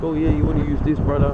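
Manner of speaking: a light imitation of a friendly local fisherman; islander fisherman. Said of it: "and he's just like".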